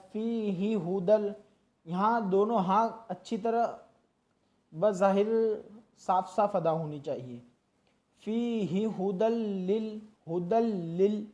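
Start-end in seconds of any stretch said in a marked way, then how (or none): 0.1-1.3 फी ही हुदल recited slowly, each sound distinct; clear Quran recitation. Shown as a demonstration. Teacher reciting for students, slow and clear. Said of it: "अच्छी तरह अदा करना है". 8.2-11.3 हुदल लिल with the लाम held long and doubled; doubled lam. Shown as a demonstration. Teacher showing the doubled lam, held long. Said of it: "लाम पर तशदीद है".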